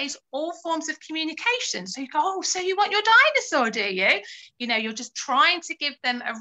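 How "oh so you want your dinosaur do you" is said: put-on high, bright talk to a toddler; a parent asking a toddler about a dinosaur toy, light and playful. Shown as a demonstration. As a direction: coax the child to answer, sing-song. Voicing parent talking to toddler.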